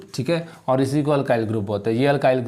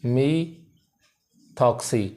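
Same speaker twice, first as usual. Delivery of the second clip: "Methoxy" very slowly, split into two stretched parts; slow syllable split; much slower than usual. Shown as a demonstration. Teacher repeating the group's name for students, "methoxy" slowly in separated parts. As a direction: stretch "methoxy" out with a gap inside the word. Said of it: "क्या इसका नाम क्या है".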